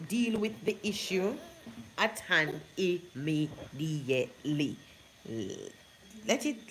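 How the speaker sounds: normal talking voice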